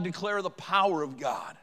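ordinary delivery

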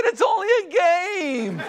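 high, slow, shouted through laughter; laughing shout. Retold or imitated. Husband defending his trick to his wife, laughing shout. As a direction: cry out the excuse while laughing.

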